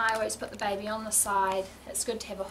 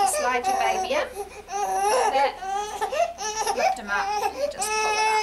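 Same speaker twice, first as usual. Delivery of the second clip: raised voice over crying; 11 semitones higher, 7 dB louder than usual. Real, not acted. Talking over crying baby.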